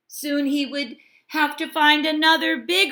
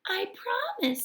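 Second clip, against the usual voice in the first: little crab; 10 semitones higher, 6 dB quieter than usual. Acted. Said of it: "said the little, little crab".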